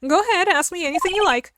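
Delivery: a touch of Southern twang; Southern woman voice. Acted. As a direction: play a sassy country gal. Southern character, eager.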